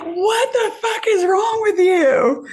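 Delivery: high, slow, laughing and crying disbelief; outraged shout. Retold or imitated. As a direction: cry it out, stunned and half laughing. Retelling being slapped, outraged.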